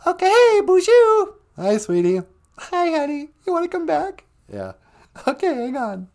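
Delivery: very high sing-song program voice; high computer voice. Pretend. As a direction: squeak like a cheerful computer. Holodeck program voice.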